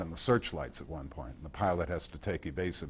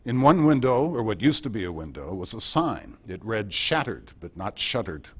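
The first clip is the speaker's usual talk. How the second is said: reading his broadcast; 7 dB louder than usual. Retold or imitated. Reading the listeners a Blitz street scene, louder, broadcast style. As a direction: give the sign line a correspondent's wry lift. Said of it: "I gotta give you a little taste".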